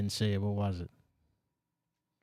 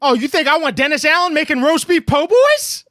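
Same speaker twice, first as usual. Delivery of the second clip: much higher and louder, disbelieving; incredulous outburst; 11 semitones higher, 9 dB louder than usual. Real, not acted. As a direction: toss the idea back in loud disbelief. Host mocks idea incredulously.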